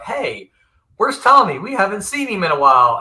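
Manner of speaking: retold puzzlement. Retold chat about absent friend.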